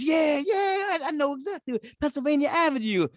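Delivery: high, teary-sounding excited mock voice; very high choked-up imitation; excited cashier. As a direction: gush like finding a long-lost neighbour. Cashier voiced, crying sound heard.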